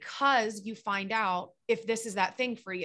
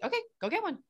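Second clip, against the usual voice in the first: blunt dare; 8 semitones higher, faster than usual. Retold or imitated. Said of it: "And I'm always like".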